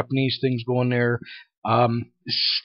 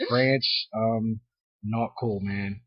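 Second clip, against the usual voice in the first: softer and lower, letting the words drop off; disappointed mutter; 4 semitones lower, 8 dB quieter, much slower than usual. Real, not acted. Scolding the France character, soft and disappointed. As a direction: quietly scold him like a let-down friend.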